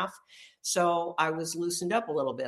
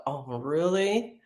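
indignant sarcastic "really"; loud, incredulous repeat; 9 dB louder, slower than usual. Retold or imitated. Complaining about husband's tone.

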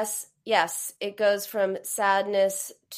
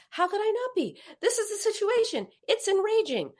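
higher, hammed-up indignant rant; mock fury; 12 semitones higher than usual. Shown as a demonstration. Therapist acting out furious self-validation, hammed-up and high. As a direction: vent with a mock furious groan. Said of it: "same formula".

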